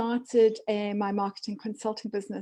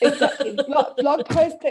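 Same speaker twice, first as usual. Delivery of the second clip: laughing through the words, louder; 4 semitones higher, 7 dB louder than usual. Real, not acted. Guest laughing at host's quip about social media, laughing. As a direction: giggle out the words.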